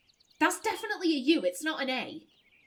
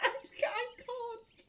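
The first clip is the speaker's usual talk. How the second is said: high, soft words through laughter; too amused to get it out; helpless laughter; 8 semitones higher, 7 dB quieter, slower than usual. Real, not acted. Helpless laughter at puzzling text.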